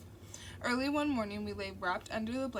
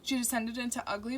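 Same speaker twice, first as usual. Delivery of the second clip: ordinary delivery, nothing marked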